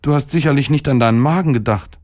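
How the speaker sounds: high, quick motherly reproach; scolding mother. A narrator's reading. Mother to her sick boy about his stomach, reproachful.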